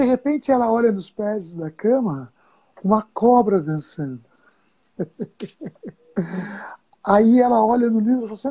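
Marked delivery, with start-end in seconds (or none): 5.0-5.9 real, very soft giggle; quiet giggle. Real, not acted. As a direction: giggle quietly at your own story. Ri da própria história. (risinho bem baixinho)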